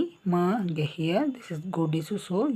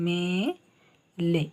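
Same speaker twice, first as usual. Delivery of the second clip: slow demo; much slower than usual. Shown as a demonstration. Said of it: "ಮ plus ಎ".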